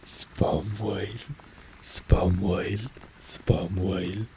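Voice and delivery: normal talking voice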